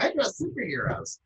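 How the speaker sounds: a light jab at a naive fan; mock fanboy. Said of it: "All you're gonna bring to the table is like".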